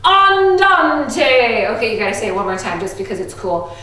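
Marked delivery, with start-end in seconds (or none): 0.0-1.7 "Andante" louder, a bit higher, stress on "dan"; andante with class. Shown as a demonstration. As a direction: lead the class saying "Andante". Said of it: "say andante".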